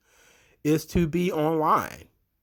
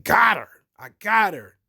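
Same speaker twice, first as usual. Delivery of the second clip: higher, louder mock-triumphant outburst; imitated excitement; 6 semitones higher, 5 dB louder than usual. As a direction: imitate a wrestler gleeful at riling someone up. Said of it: "he was just excited".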